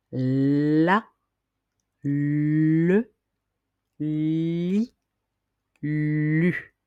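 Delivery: la, le, li, lu each clearly articulated with pauses; syllables la le li lu. Shown as a demonstration. Teacher models la le li lu for pupils to repeat. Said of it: "vous allez répéter après moi".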